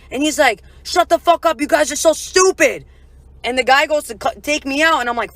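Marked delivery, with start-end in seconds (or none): 0.8-2.8 raised, higher and louder mock irritation; mock angry outburst; retold angry bouncer. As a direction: imitate a fed-up bouncer cutting the girls off. Bouncer snaps at girls.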